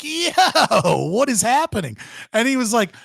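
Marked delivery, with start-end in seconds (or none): 0.0-2.0 high, loud, laughing "yo"; laughing disbelief. Retold or imitated. Retelling his own reaction in training, laughing and high. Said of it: "And I was like".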